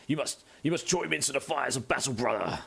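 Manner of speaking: lightly voiced gruff space soldier; a hint of a solemn battle brother; Space Marine voice. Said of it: "Should have done some voice acting".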